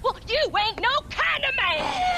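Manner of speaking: a wife screaming at her husband; furious and tearful outburst; crying shout. Acted.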